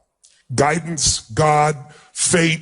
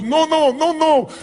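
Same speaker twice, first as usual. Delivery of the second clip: much higher-pitched retold voice; imitated insistent woman; 11 semitones higher, slower than usual.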